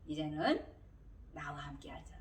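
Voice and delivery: low, hushed divine invitation; voice of God. Retold or imitated. God inviting the believer to walk together, hushed and low. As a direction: drop to a soft, near-whispered summons. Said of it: "하나님이".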